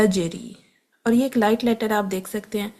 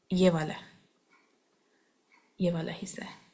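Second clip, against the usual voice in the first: whisper; 3 semitones lower, 8 dB quieter than usual. Real, not acted. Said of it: "whispered aside while pointing".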